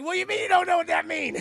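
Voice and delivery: high, loud, rushed disbelief; mock-flabbergasted rapid-fire yelp; incredulous outburst. Friend to a racer who cannot drift, incredulous outburst.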